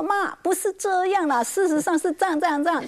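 lightly acted daughter setting mother straight; retold correcting. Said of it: "很想要跟他纠正过来".

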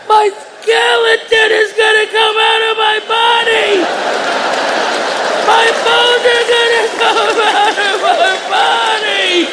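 put-on high, wailing scared-kid voice; high singsong child panic, slow on bones; panicked child. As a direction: cry out like a terrified toddler at night. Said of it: "my daughter's like".

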